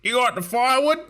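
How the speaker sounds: high-pitched broad Aussie bloke impression. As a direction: hail them like a mock Aussie mate. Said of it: "These guys were really Aussie".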